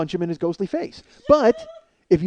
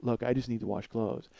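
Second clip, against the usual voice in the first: low, soft, imitated calm; mimicking an unruffled neighbor; never raising his voice; 8 semitones lower, 10 dB quieter than usual. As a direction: answer quietly without engaging. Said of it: "he never raises his voice".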